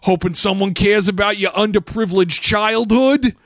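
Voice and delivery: high, brash New York wise-guy read; that New York accent. Acted. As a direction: heckle in broad, hammed-up New Yorkese. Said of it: "read any line of dialogue in that accent".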